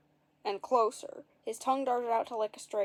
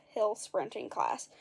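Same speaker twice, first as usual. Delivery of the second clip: ordinary delivery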